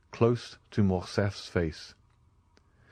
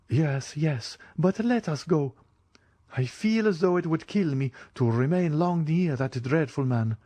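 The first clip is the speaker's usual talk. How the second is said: frightened; 7 semitones higher than usual. Acted. Frightened girl begs to leave.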